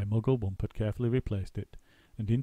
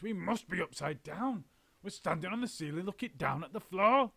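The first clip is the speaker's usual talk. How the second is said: dazed Mister Twit; 9 semitones higher, 4 dB quieter than usual. A narrator's reading. Mister Twit bewildered telling his wife. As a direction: mutter it in disbelief.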